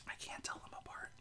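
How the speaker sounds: whispered confession. Real, not acted. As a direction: whisper it like a secret. Confessing she can't tell accents.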